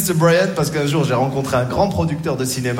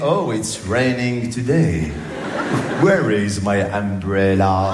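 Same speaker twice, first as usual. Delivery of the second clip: textbook English; 7 semitones lower, much slower than usual. Retold or imitated. Absurd textbook phrases.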